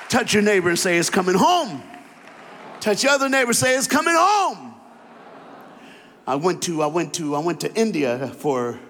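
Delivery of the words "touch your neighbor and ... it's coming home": higher and louder call to the crowd; rally the room to repeat it; preaching call-out. Acted. Preacher calling the congregation.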